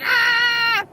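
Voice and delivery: loud high mock shriek of terror; imitated dog's scream. Terrified beagle seeing another dog coming, mock shriek. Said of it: "She's like".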